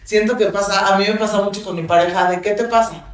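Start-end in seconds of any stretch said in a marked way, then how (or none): none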